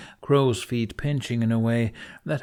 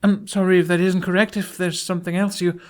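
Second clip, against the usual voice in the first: nervous apology; 9 semitones higher, 6 dB louder than usual. Acted.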